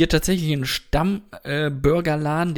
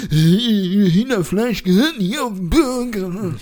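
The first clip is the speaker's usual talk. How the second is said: slow, louder, indignant put-on voice; indignant critic; 5 semitones higher, 5 dB louder, much slower than usual. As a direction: play a snooty burger purist. Quoting people who judge his order. (empört, langsam, belehrend)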